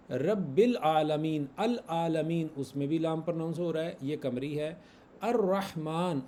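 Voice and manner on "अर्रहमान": अर्रहमान slowly, with a held double R; silent lam, doubled R. Shown as a demonstration. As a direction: skip the L, lean on the R in अर्रहमान. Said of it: "यहाँ पर लाम pronounce नहीं हो रहा". (अर्रहमान, लाम के बिना, र पर शद)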